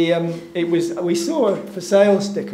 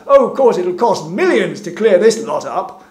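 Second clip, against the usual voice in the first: imitating a man calling out very loudly; loud shouted remark; 3 semitones higher, 10 dB louder than usual. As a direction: boom it out for all to hear, mimicking him. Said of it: "shouted out in a very loud voice".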